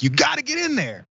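high, pushy mock order; 高声急促地催; urgent official. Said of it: "runs me in like".

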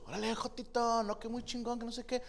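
high-pitched hyped-up coach praise; imitating coach. Coach cheering him on, in a high mock voice. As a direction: gush like an excited coach. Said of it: "Y de repente mi coach".

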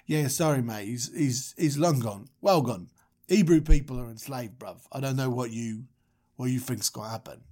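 low, matey Moses voice; offhand Moses. Acted. Moses telling God the ancestors are gone, low and offhand. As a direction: play a doubtful bloke giving bad news, voice dropped.

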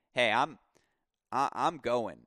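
normal talking voice

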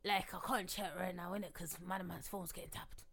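London roadman slang voice; london roadman accent. Retold or imitated. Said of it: "you were doing your roadman voice".

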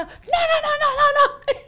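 very high, loud mimicked "no no no"; mock frantic refusal. Mimicking the man's refusals at the gate, very high and loud. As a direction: squeal the refusals like the man. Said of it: "Y el tipo empezó".

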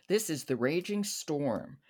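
normal talking voice

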